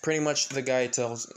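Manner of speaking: usual speaking voice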